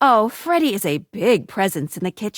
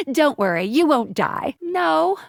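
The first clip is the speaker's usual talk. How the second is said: normal talking voice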